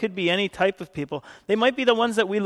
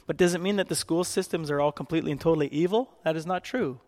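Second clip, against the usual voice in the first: usual speaking voice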